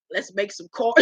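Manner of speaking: soft, slurred bad-teeth impression. Host voicing castmate with kids, soft mock slur. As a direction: imitate him quietly before cracking up.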